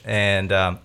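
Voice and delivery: ordinary talk, nothing marked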